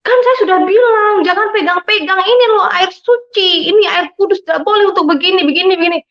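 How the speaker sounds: high-pitched, exasperated telling-off; retold scolding. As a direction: scold someone who touched what is sacred. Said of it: "saya dimarahin".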